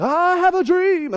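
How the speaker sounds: slow, drawn-out preacher voice; Martin Luther King. Retold or imitated. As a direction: stretch it out like a gospel preacher. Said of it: "low rumbling, awesome gospel voice".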